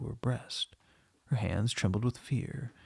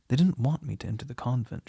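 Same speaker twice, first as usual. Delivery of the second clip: ordinary delivery, nothing marked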